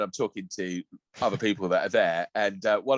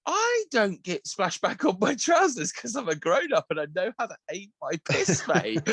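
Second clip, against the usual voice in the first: laughing; 10 semitones higher than usual. Retold or imitated. Retort to stranger, laughing.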